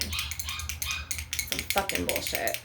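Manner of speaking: slow, whispered, disgusted curse; muttered under breath. Real, not acted. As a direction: mutter it to yourself, slowly. Muttered curse during vent.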